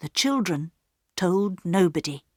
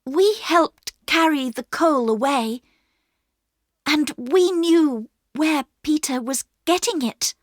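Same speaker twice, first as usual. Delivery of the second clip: higher, brave confession; girl Bobby; 9 semitones higher than usual. A narrator's reading. Bobby to the station master, bravely confessing. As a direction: own up beside her brother.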